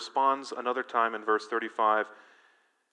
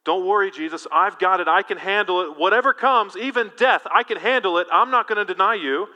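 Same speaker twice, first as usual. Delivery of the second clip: brash boast, higher and louder as it builds; overconfident Peter; 6 semitones higher, 8 dB louder than usual. Retold or imitated. Peter assuring Jesus he can handle it, cocky and loud. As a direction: puff up like you can take on anything. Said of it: "Peter gets ahead of himself".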